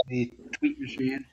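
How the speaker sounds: ordinary delivery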